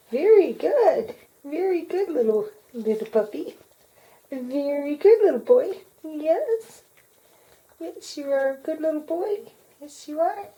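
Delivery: high, sing-song puppy praise; squeaky praising a dog. Real, not acted. Praising puppy after trick.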